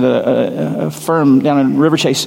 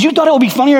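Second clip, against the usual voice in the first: incredulous; 12 semitones higher, much faster than usual. Retold or imitated. Coworker disbelieving at the prank.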